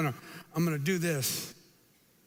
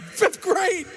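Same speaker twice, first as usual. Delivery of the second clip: emphatic repeat; 8 semitones higher than usual. Real, not acted. Speaker echoing his own words to the audience, shouted. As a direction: belt it out as the punchline.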